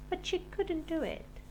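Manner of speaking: plain reading voice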